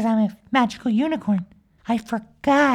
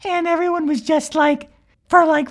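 ordinary delivery, nothing marked

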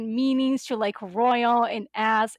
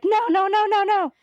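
high, louder rush of no's; mock panic, trying to stop it; frantic; 10 semitones higher, 5 dB louder, faster than usual. Retelling a scramble to pause autoplay, frantic high protest. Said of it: "frantically had to pause".

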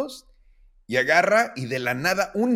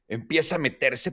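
ordinary talk

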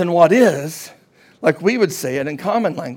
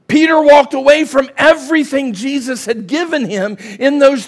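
high, raised preacher's voice; preaching at full force; 6 semitones higher, 4 dB louder than usual. Real, not acted. Preacher tells how Peter went back to fishing, full-force preaching. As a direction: drive it home high and forceful, stretching key phrases.

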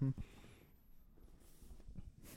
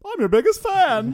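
creepy fan voice; 13 semitones higher, 10 dB louder than usual. Pretend. Creepy mock line at the screen.

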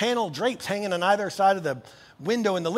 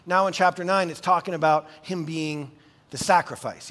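usual speaking voice

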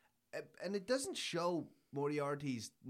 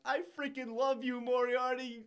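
much higher and louder, gushing with glee; hysterical delight; 12 semitones higher, 7 dB louder than usual. Retold or imitated. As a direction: burst out giddy with admiration. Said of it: "starts laughing hysterically".

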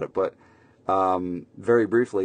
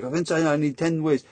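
mock eager client; 8 semitones higher than usual. Imitating an eager client asking him.

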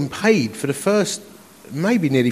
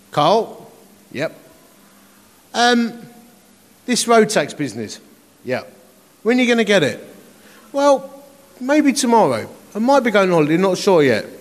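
June on the phone; 5 semitones higher than usual. Retold or imitated. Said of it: "June phones me up".

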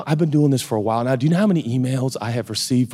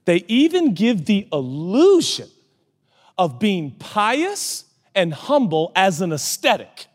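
slow, raised, weighty scripture delivery; dramatic preaching; 10 semitones higher, slower than usual. Real, not acted. Preacher pressing a verse on his congregation, slow and dramatic. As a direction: stretch each phrase out for the congregation.

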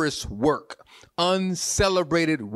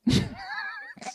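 high laugh; 5 dB quieter than usual. Real, not acted. Laughs at own church joke.

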